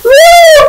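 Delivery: real whoop after a laughing fit; high loud whoop. Real, not acted. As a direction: let out a high, loud "woo".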